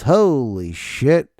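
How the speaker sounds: usual speaking voice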